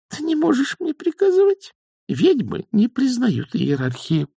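very high, squeaky defiance; defiant young witch. Acted. Young witch rejects elder's authority, piping defiance. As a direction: stand up to her in a high voice, slowing.

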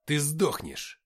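loud growling threat; growled threat. A narrator's reading. Suvor threatens the possessed man, growled threat. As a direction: promise death with a growl. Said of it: "прорычал я".

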